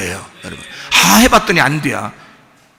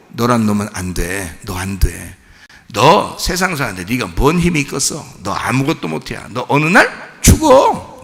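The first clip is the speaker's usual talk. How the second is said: low devil taunt; 6 semitones lower than usual. Retold or imitated. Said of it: "사람들을 어떻게 해?".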